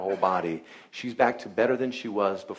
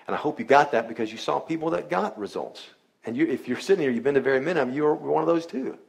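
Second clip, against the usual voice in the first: rising excitement; 5 semitones higher, 4 dB louder than usual. Real, not acted. Preacher rallies the audience.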